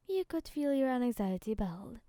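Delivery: plain reading voice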